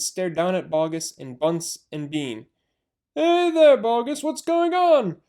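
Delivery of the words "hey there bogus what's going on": high, loud calling villager; jeering villager. Acted. Villager calling into the crater, high loud jeer. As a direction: call down mockingly.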